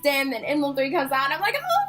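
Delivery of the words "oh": high, drawn-out "oh"; re-enacted excited squeal. Concertgoer as Emblem Three comes out, re-enacted high squeal. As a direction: relive the squeal as the band appears. Reliving the band coming out.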